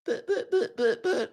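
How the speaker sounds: tiny high stammer; mock sputtering. Trump supporters lost for a comeback, mock sputtering. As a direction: imitate flustered opponents, soft and squeaky-high. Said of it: "they were just like".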